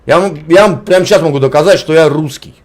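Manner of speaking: usual speaking voice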